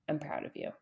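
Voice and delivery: softly voiced reassurance, imitated; quiet encouragement. Therapist encouraging a client after a failure, quiet encouragement. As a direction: say it low and gently, as quoted support. Quoting a supportive therapist's praise.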